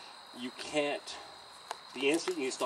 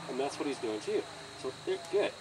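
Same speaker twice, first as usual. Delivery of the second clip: ordinary talk, nothing marked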